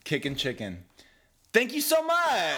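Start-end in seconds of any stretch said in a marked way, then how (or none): 1.5-2.6 high, drawn-out gushing shout; over-the-top thanks. Retold or imitated. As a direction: gush thanks so hard it sounds sarcastic. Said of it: "he took it as sarcasm".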